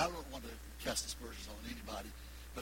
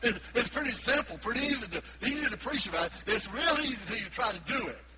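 higher, louder insistence; emphatic preaching; 8 semitones higher, 6 dB louder than usual. Real, not acted. Preacher insisting love is hard, loud. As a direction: push it hard.

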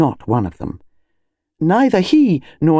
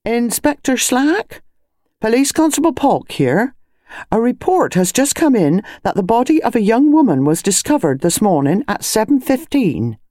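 high, official phone report; police constable; 8 semitones higher than usual. A narrator's reading. Police constable reporting to his inspector, high-pitched and official. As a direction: constable formally announcing himself and his news.

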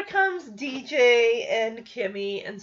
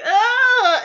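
loud high retold groan of disgust; a big grossed-out ugh; 11 semitones higher, 11 dB louder than usual. Audience recoiling at the gross snack, loud disgusted groan.

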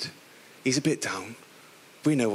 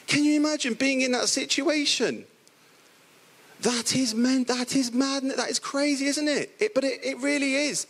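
amazed; 9 semitones higher than usual. Real, not acted. Preacher marvels at the impossible.

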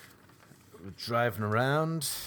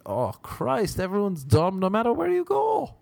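high, rising wail of despair; exasperated; 8 semitones higher than usual. Acted. Comic concluding everyone is dumb, high exasperated lament. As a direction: throw up your voice in mock despair.

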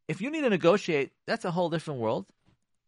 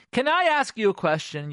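higher, louder pointed question; accusing question; 6 semitones higher, 5 dB louder than usual. Shown as a demonstration. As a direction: ask it like an accusation. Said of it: "Question, criticism".

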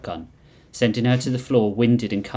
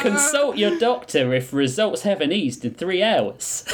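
high, slow ad read; mock TV ad voice; drug ad disclaimer; 7 semitones higher than usual.

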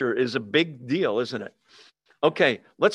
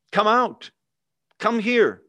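king's shout; 6 semitones higher, 4 dB louder than usual. Retold or imitated. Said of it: "He shouted".

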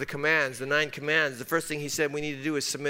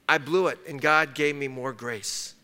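ordinary delivery

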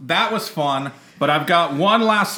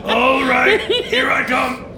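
higher, laughing, out of breath; lumbering ghost; 6 semitones higher than usual. Acted. Possessed host lumbering toward co-host, higher and breathless. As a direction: struggle forward in a borrowed body. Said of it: "This body is very hard to move".

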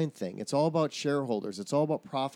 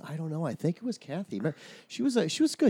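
sweet demo; 4 semitones higher, 5 dB louder, much faster than usual. Shown as a demonstration. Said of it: "what you need to say is".

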